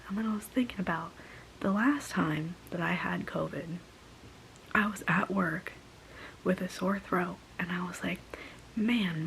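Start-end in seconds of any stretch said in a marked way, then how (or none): none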